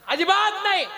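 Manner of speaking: high, loud outburst; indignant denial. Acted. Kauravas disbelieve the brother's answer, indignant denial. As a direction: reject it forcefully. Acted quarrel, flat disbelief.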